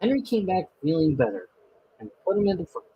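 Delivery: ordinary delivery, nothing marked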